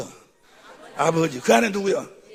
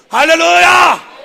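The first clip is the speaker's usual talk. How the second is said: slow, long, much louder cry; shouted hallelujah; 8 semitones higher, 17 dB louder, much slower than usual. Real, not acted. Preacher crying out to his congregation, drawn-out shout. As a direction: fling it out to the whole room.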